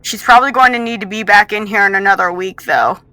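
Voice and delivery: loud, brisk order to an assistant; calling out. Acted. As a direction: summon someone from across the lab. Captor calls out to assistant.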